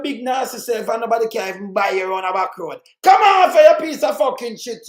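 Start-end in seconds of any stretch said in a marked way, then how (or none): 3.0-4.9 loud, higher angry yell; real angry shout at the politician; furious shout. Real, not acted. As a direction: spit out the insult at full volume. Insulting politician in rant.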